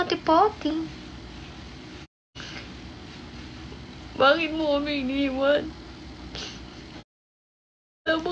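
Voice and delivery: lightly acted dramatic plea; a hint of a soap-opera actress calling out; mock dramatic actress. Practicing acting lines for viewers, a touch theatrical. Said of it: "pangarap ko maging artista".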